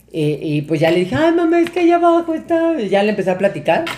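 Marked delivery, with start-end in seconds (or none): 1.2-2.8 high-pitched tearful whine to mom; mock crying. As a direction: blubber it out like a scared kid. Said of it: "me puse a chillar así, pero del miedo". (lloriqueo agudo imitado)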